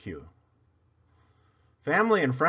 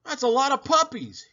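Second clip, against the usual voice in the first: much higher, louder surprised father dog; 11 semitones higher, 4 dB louder than usual. Retold or imitated. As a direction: marvel at all the puppies. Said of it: "he said".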